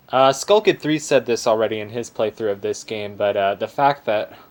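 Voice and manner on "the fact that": ordinary delivery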